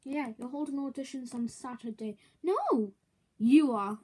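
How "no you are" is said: gloating retort. Acted. Handing off punishment, gloating.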